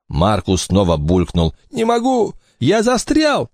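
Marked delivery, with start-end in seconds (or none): 1.7-3.5 high, frantic desperate cry. Acted. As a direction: cry out stuck and scared. Stuck climber cries out.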